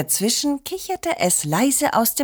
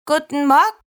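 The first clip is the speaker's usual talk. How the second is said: higher, lively greeting; bright child greeting; 6 semitones higher, 4 dB louder, faster than usual. A narrator's reading. Liliana greets Oma at breakfast, higher and lively. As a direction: chirp a morning hello, higher.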